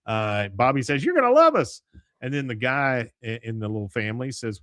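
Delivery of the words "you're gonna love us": high, loud mock eagerness; acted eager greeting. Bobby assures the new neighbors, high and loud. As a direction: pitch it way up and sell it.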